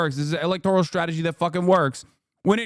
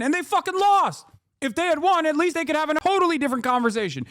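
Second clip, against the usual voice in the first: heated outburst; 8 semitones higher than usual. Real, not acted. Ranting about the Democrats losing.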